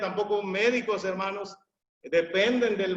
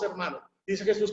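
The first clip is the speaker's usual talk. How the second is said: normal talking voice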